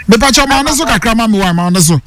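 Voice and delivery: normal talking voice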